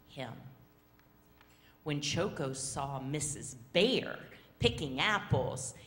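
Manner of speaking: usual speaking voice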